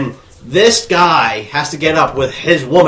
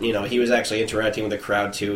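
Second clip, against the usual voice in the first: usual speaking voice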